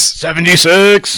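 loud, slow drawn-out call; drawn-out shout. Real, not acted. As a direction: stretch the number out loudly. Calling out the game's name.

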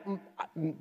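usual speaking voice

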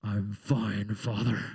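very low, ominous reply; mock a daughter's loaded "I'm fine"; imitated upset daughter. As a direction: sink the voice far down, heavily. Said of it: "If I ask my daughter".

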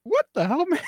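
mock disbelief with a laugh; baffled. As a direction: thrown completely by the creep. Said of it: "It's like".

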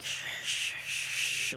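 whispered shushing hiss; breathy shh like recorder noise; shushing static. Retold or imitated. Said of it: "there's a lot of, like".

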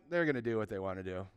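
quick, low, soft aside; low resigned aside. Acted. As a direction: say it low and fast, as if to yourself. God remarks resignedly, aside.

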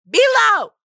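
high, loud victory yell; triumphant shout. Real, not acted. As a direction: shout it like you won. Triumphant after spelling bee story.

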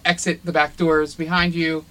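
usual speaking voice

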